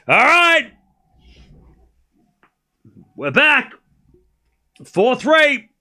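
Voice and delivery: high, loud bursts with pauses between; real sports-fan excitement; excited celebration. Real, not acted. Celebrates regained lead.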